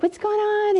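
high-pitched retold question; retold concerned mom. Mom asking her moody son, high and probing.

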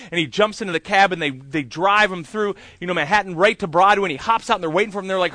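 normal talking voice

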